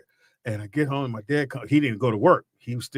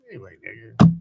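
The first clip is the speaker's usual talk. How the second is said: dismissive brush-off; 4 semitones lower, 11 dB louder than usual. Retold or imitated. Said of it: "I'm like".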